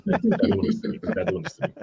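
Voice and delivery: high-pitched mock refusal; squeaky high voice. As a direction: squeak it out playfully. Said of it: "He's not doing this because he's gone".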